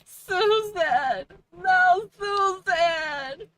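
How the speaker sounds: mock wailing Susan. Streamer mock-mourning Susan after reading a widow's review, loud hammed wail. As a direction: wail the name like a melodramatic widow.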